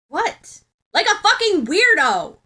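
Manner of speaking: high, loud angry bark. Acted. Bakugo barks at Ochako across the cafeteria table, loud. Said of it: "Bakugo barks louder than necessary".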